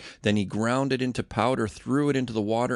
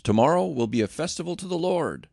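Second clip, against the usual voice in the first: raised, higher proclamation; Aaron proclaiming the festival, raised voice; announcing; 7 semitones higher, 3 dB louder than usual. A narrator's reading. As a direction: proclaim the feast to all. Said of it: "Then he announced".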